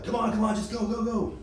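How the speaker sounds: high urging lifeguard voice; impatient lifeguard shooing people along; hurried lifeguard. Retold or imitated. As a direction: hurry everyone up the stairs.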